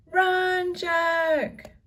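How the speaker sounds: high, loud shout of warning. A narrator's reading. Annie warns Jack of the charging pirates, high loud shout. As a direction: scream a warning at him. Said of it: "shouted Annie".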